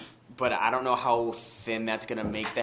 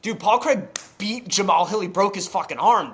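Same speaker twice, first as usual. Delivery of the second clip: excited outburst; 10 semitones higher, 8 dB louder than usual. Real, not acted. Hyped fan shouting to his friend about Paul Craig's win, excited. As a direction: pitch jumps way up, loud and fired up.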